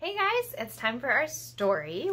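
plain reading voice

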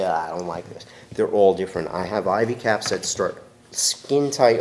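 ordinary talk

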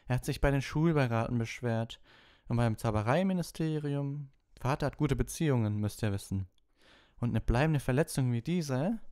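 high, self-important put-on voice; smug schoolboy. A narrator's reading. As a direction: boast of father's influence, pitched high. Malfoy boasts of father's connections.

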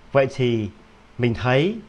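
ordinary talk

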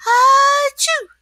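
high loud explosive sneeze; huge sneeze. Acted. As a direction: blast out a sneeze. SpongeBob sneezes.

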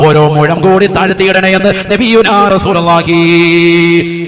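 loud, intoned sermon with long-held line endings; full-force preaching. Real, not acted. Preacher rebukes the community over social media, loud chanted exhortation. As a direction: preach at full volume, rising high and chanting the closing words.

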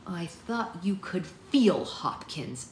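slow, pointed accusation; measured reproach; accusing. A narrator's reading. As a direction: lean on the words slowly as you accuse. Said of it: "accused Essie".

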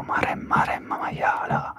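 Maremma maiala in a mock Tuscan accent, stretched and punchier; whispered Tuscan curse. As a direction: imitate a Tuscan swearing, slowing on maiala. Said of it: "E invece i toscani dicono". (imitazione toscana di Maremma maiala, sussurrata ma più forte)

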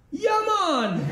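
put-on high, falling caricature of a Jamaican accent; mock Jamaican catchphrase. Comic mocking outsiders greeting a Jamaican, exaggerated fake Jamaican accent. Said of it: "Would even sound like that?".

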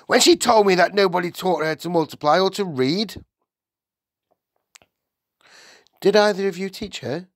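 high, insistent schoolteacher; questioning Miss Honey. A narrator's reading. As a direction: press the parents for an answer.

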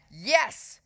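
sharp, high snapped yes; curt teacher's snap. A narrator's reading. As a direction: snap it loudly, pitch jumping up. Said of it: "snapped Miss Battleaxe".

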